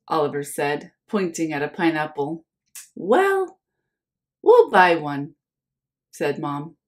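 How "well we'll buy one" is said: mom voice. Acted. Mom answers Oliver.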